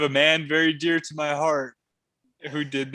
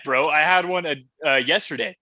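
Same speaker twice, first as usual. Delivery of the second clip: ordinary talk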